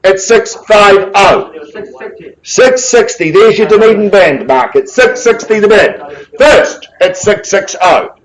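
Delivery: rally the room for opening bids; auction calling. Real, not acted.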